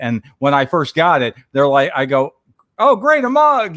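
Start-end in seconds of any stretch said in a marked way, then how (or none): 2.8-3.8 higher, mock cheerful thanks; fake excitement. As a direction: pretend to be thrilled by the gift. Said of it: "I'm trying to act excited about it".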